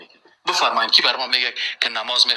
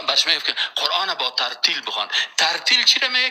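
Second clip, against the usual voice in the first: ordinary delivery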